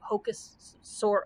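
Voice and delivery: ordinary delivery, nothing marked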